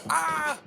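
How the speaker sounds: "Ah" shot up high like a mock movie scream; high, open "Ah" with no R; Bostonian R. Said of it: "pronounce it like a Bostonian".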